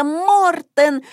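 high exclamation as if all join in; chorused exclamation. A narrator's reading. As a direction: exclaim the name high, at normal volume. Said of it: "воскликнули все хором".

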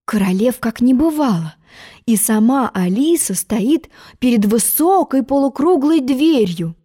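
plain reading voice

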